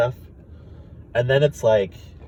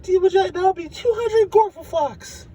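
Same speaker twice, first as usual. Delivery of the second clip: squeaky alien clerk. Retold or imitated. Alien clerk telling Rick the price, very high squeak. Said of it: "they're like".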